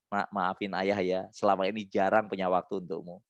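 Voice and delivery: soft, low parent's apology; apologising to child. Shown as a demonstration. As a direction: demonstrate a tender sorry to your child. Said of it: "minta maaf".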